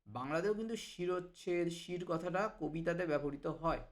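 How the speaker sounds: usual speaking voice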